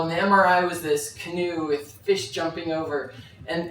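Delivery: ordinary talk, nothing marked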